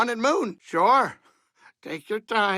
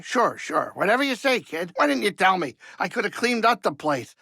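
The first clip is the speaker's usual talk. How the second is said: normal talking voice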